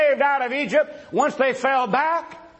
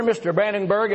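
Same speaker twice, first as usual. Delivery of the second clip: ordinary delivery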